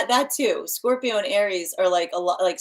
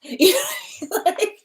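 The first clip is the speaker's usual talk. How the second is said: laughing; 13 semitones higher, much slower than usual. Real, not acted. Laughing at own joke.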